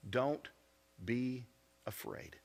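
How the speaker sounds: quiet, tender, the last word breathed; soft reassurance. Shown as a demonstration. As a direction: lay the words down softly like comforting a child. Gentle reading of God's words.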